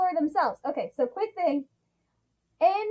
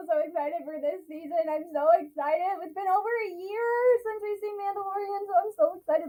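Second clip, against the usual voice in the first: ordinary talk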